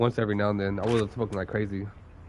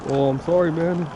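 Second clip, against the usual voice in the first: apologetic supervisor; 7 semitones higher, 6 dB louder than usual. Retold or imitated. Imitates supervisor apologizing again.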